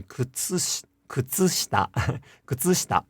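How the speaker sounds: kutsushita with the u of tsu fully voiced; second sound voiced. Shown as a demonstration. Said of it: "will not devoice the second sound".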